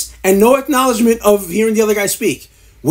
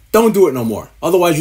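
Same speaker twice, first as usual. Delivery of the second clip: ordinary delivery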